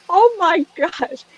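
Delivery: high, slow oh my gosh; gasping at the realization; retold surprise. Psychic recalling the moment she caught on, high and surprised. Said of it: "and I went".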